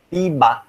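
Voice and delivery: "Tiba" with a hard T, TEE-ba; unpalatalized T. Shown as a demonstration. Teacher repeating the expected ending, "Tiba" with plain T. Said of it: "they are supposed to say".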